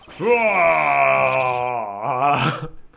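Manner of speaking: failed lion roar with a laugh; attempted roar. Shown as a demonstration. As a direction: try to roar, half laughing. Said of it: "I can't roar".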